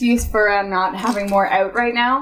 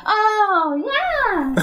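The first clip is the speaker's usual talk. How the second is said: high, drawn-out "oh, yeah"; dawning recognition; 10 semitones higher than usual. Retold or imitated. Cinema staff finally understand the customer's request, dawning recognition. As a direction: act out the penny finally dropping, pitched way up. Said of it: "And they're like".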